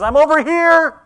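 put-on high, loud little-girl call from hiding; daughter calling out. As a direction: call out like a small child found in hide and seek. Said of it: "she says".

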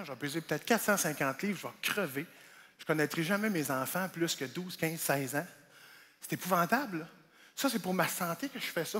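quiet down into a grim realization; somber reflection. Retold or imitated. Comic brooding over his health, low and soft.

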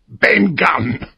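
a high, odd castaway voice; lightly voiced wild castaway naming himself; strange voice. A narrator's reading. To Jim on the island, strange voice. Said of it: "he answered in a strange voice".

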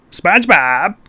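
high, slow, drawn-out calls for SpongeBob; Squidward impression. Calling a neighbour over as a cartoon squid, high and slow. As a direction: stretch each call out high like a cartoon squid. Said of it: "That's my Squidward impression".

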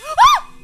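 a sharp gasp that breaks into a very high, loud scream; startled gasp and scream. Real, not acted. Gamer scared by a creature in the baby's room, shrieking gasp. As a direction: jump out of your skin and shriek.

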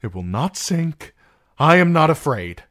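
loud, high forced bravado; frightened self-talk. A narrator's reading. Sam insisting to himself he is not afraid, fearful. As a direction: insist on it, voice climbing with fear. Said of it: "he told himself".

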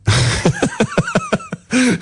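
squeaky high-pitched laugh; high cackle. Real, not acted. Radio host laughing at his own on-air joke, high squeaky laugh. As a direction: burst into a tiny squeal of laughter.

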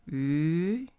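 Yu rising upward; second tone yu. Shown as a demonstration. Teacher models the second tone, rising. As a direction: glide Yu up. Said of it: "going up".

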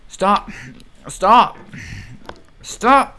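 high loud escalating screams of stop; screaming stop. Real, not acted. Gamer begging the game to stop, rising high screams. Repeated screams while falling.

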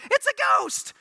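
high, loud panicked yelp; mock frightened cry. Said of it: "fear will make you say some dumb things".

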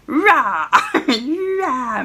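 short mock roar; playful dragon roar. Player roaring along with the dragon, a playful mock roar. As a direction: roar like a dragon, just for fun.

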